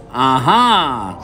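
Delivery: knowing aha. A narrator's reading. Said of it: "cried Mister Wonka".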